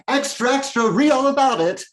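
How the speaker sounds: loud, high-pitched headline shout; newsboy cry. Acted. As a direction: holler it like a street paper seller. Mock newsboy crying a headline.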